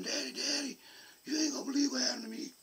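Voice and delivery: put-on excited kid. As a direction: rush it out like a boy running in. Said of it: "all excited".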